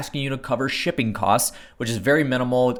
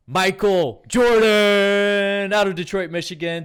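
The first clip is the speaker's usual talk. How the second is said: announcer hype; 7 semitones higher, 7 dB louder, slower than usual. Acted. Hyped guest introduction.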